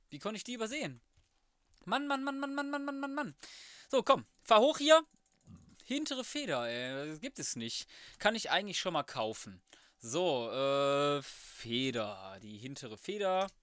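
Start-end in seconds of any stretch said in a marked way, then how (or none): none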